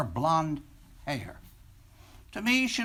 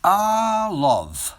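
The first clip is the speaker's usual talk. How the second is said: swooning love sigh; 8 dB louder than usual. Acted. Book opening, dreamy sigh of love.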